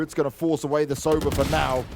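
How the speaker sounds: ordinary talk, nothing marked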